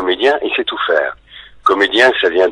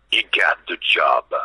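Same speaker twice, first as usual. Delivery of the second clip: Schwarzenegger; 4 dB quieter, slower than usual. Retold or imitated. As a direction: announce it slowly, imitating the action star. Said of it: "j'ai entendu derrière". (imitation lente et appuyée de l'acteur américain)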